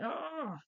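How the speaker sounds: a high startled "Oh!" leaping way up; shocked gasp. Retold or imitated. Raphael sees his brother knocked out, a high shocked "Oh!". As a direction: gasp as if you just saw it happen.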